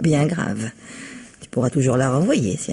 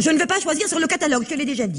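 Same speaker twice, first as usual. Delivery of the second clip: fast, much higher, irritated; fed up with being told again; exasperated outburst; 10 semitones higher, faster than usual. Acted. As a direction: snap back in annoyance. Quarrel over the wheelchair catalogue.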